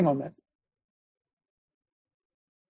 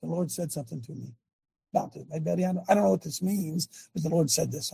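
understated word for somebody; 3 semitones lower, 8 dB quieter than usual. Acted. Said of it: "not the same as having a word for somebody".

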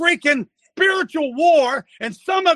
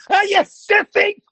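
yelling; 6 semitones higher than usual. Real, not acted. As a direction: yell at them like a coach. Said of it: "he does so much yelling".

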